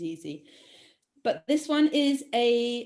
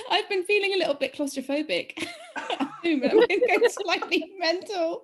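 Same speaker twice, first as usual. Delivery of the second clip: high, loud, helpless laughter through the words; words cracking up into giggles; laughing confession; 8 semitones higher, 4 dB louder than usual. Real, not acted. Quizmaster admitting cabin fever to friends, laughing.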